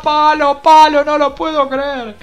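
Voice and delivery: loud, high disbelieving shout; stunned shout. Real, not acted. Fan seeing his team hit the post, shouting in disbelief. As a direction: yell as the ball hits the post.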